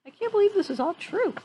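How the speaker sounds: plain reading voice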